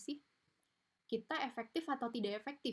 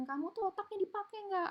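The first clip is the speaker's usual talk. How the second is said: much higher, sneering mock scold; insulting parent; 11 semitones higher than usual. Harsh parent insulting the child, higher mock scold. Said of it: "Kayak misalnya ngatain".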